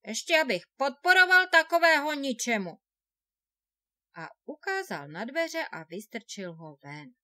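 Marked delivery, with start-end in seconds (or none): 0.1-2.7 loud contemptuous outburst; angry shout. A narrator's reading. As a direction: throw him out with a loud angry yell. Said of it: "Obořil se naň bratr".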